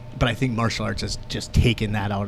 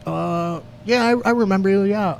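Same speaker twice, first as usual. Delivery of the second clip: louder, higher sheepish dentist; a dentist caught out, voiced higher and louder; 8 semitones higher, 3 dB louder than usual. Dentist apologising to the patient, higher and louder.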